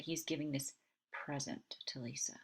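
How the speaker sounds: pause, then lean on PRESENT; stress on PRESENT. Shown as a demonstration. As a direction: softly, PRESENT set apart after a pause. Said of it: "it would be emphasizing that it's a present".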